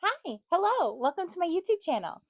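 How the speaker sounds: high, bright-pitched channel greeting; youtuber intro. Acted. Vlogger opening her video with a high host greeting. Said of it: "I don't like how I said that".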